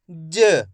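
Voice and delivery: ज as a short juh, no aa; half-sounded letter. Shown as a demonstration. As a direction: cut the vowel of ज down to a brief uh. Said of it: "last वाले अक्षर का उच्चारण अपन आधा करेंगे".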